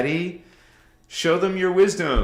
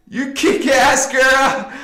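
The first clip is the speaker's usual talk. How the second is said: higher and louder, slowed, hyped-up praise; excited praise; 9 semitones higher, 5 dB louder, slower than usual. Real, not acted. Streamer praising a viewer, high and hyped. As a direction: hype her up.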